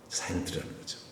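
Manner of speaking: softly whispered, under the breath; whispered repeat. Shown as a demonstration. (속삭이듯 조용히 반복)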